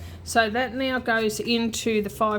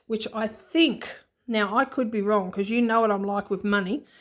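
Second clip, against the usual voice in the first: ordinary delivery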